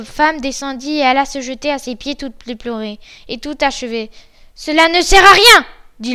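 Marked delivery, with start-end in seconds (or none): 4.5-5.7 high, loud dismissal; angry refusal. Acted. Bluebeard rejects his weeping wife's pleas, loud and angry. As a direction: cut off her pleading forcefully.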